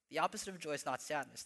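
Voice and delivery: fast, lighter run-through of "The opposite of joy is not sadness"; quick restatement. Shown as a demonstration. Pastor repeating the quote to the church, quick and offhand. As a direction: toss the line off quickly as a recap. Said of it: "he says it pretty simply this way".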